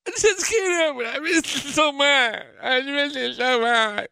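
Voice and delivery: very high, whiny crying imitation; mock weepy wife. Husband mimicking wife pining for son at camp. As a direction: wail it up high, heartbroken and tipsy.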